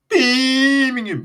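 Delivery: put-on loud, very high, long-drawn Birmingham accent; hammed-up Birmingham accent. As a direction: wail it out in a mock Birmingham accent. Exaggerated accent on repeated town name.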